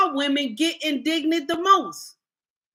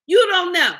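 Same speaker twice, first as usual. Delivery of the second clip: higher and louder, you don't know; a sharp raised retort; emphatic outburst; 5 semitones higher, 6 dB louder than usual. Real, not acted. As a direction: punch it out, exasperated. Ranting on a livestream.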